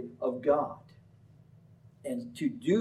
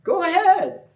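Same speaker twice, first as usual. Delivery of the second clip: much higher, louder mock cheer; sarcastic; 10 semitones higher, 8 dB louder than usual. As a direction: pour on the sarcasm, cheering falsely. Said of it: "he uses sarcasm".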